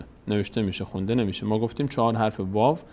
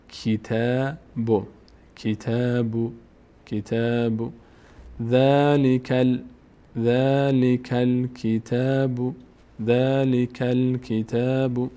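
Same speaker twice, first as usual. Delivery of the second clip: level, drawn-out syllables with long held vowels; slow model reading; much slower than usual. Shown as a demonstration. Teacher modelling the words for learners, slow measured reading. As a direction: recite it slowly on one even note.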